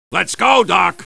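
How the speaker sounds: ordinary talk, nothing marked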